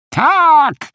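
high, loud, drawn-out call; grandpa's booming retort. A narrator's reading. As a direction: thunder it back as an insistent grandfather. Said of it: "huknął dziadzio Feluś". (głośne, wysokie huknięcie dziadka)

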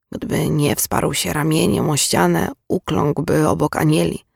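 usual speaking voice

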